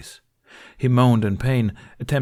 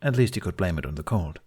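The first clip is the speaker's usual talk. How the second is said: ordinary delivery, nothing marked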